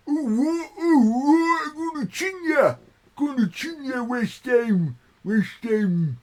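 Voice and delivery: high, loud hammed-up Cockney threat; mock Cockney. Teasing a friend's speech with a mock Cockney threat. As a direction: play a Cockney lad squaring up. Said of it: "Is that how you speak?".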